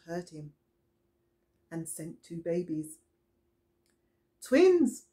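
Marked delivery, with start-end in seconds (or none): 4.4-5.0 bright exclaimed reveal, higher and louder; excited. A narrator's reading. Teacher revealing twins to children, excited. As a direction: announce the twins like a surprise.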